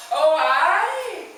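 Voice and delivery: loud, high, drawn-out Northern Irish aye; Northern Irish accent. A narrator's reading. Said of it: "with a Northern Irish accent".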